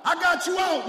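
high loud punch on got; a second shouted I got you out. Acted. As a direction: drive the line home again. Repeated preaching climax.

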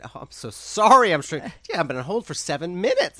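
retold over-the-top sorry; gushing apology. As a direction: apologize effusively, a laugh creeping in. Retold flustered apology to Oprah's caller.

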